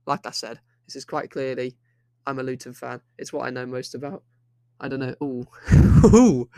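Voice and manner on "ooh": loud cracking squeak. Real, not acted. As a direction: voice breaks on a loud ooh. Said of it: "Ignore that voice crack".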